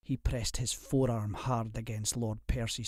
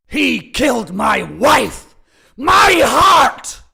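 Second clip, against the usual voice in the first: much louder, higher anguished raging; raging cry; 12 semitones higher, 15 dB louder, slower than usual. A narrator's reading. Widower raging over his murdered wife, anguished roar. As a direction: rage it out with your head thrown back. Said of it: "he cried".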